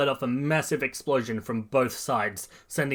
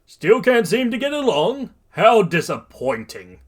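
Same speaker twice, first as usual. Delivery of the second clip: booming hero taunt; 9 semitones higher, 8 dB louder than usual. A narrator's reading. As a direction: chide them in a big theatrical voice. All Might taunts captured Bakugo.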